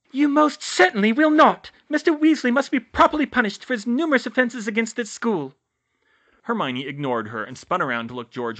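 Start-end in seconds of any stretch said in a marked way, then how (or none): 0.1-5.5 very high, loud scolding; indignant high-pitched official; outraged headmistress. A narrator's reading. Umbridge refuses.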